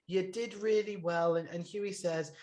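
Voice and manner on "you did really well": higher, softer pep-talk voice; Nanny. Retold or imitated. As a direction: gently voice a reassuring pep talk, pitched up. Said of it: "then Nanny was like".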